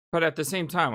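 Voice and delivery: ordinary talk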